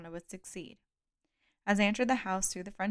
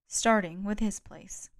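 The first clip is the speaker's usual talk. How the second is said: plain reading voice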